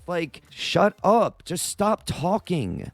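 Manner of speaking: slow, exasperated scolding. Real, not acted. To Trump about his words, slow exasperated scolding. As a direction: spell it out slowly, fed up.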